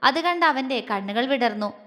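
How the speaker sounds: ordinary delivery, nothing marked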